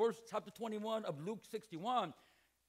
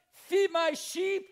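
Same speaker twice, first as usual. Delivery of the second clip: drawn-out, loud and high; mocking command; 8 semitones higher, 8 dB louder, much slower than usual. Preacher mocking Jesus's order to Peter, slow and loud. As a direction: stretch each word out in mock insistence.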